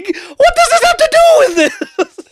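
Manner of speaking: squeaky high quote breaking into laughter; laughing mock Pee-wee. Laughing while quoting Pee-wee to friends, high and loud. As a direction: try to hold the voice through giggles.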